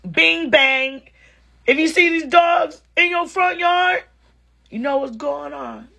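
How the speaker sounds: high-pitched imitation of a New Orleans accent; attempted Nola accent. Comic trying out New Orleans talk, high put-on accent. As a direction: chant it in a would-be Nola accent. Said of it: "That wasn't it. ... I was close".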